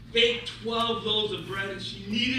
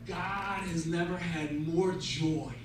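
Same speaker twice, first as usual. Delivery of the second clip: wise rabbi; 6 semitones lower, 4 dB quieter than usual. Acted. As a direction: explain it gently, with weighty pauses. Wise rabbi explains God's joy.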